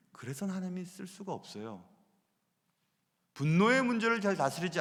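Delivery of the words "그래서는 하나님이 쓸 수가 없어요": ordinary delivery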